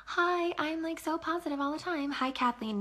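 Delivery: high, gushing fake cheer; mock the over-bubbly seller; mock bubbly fake positivity; fake positive. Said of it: "Not fake positive".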